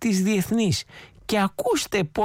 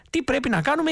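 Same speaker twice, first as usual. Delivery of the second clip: high questioning prompt; 6 semitones higher than usual. Shown as a demonstration. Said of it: "ήρεμα".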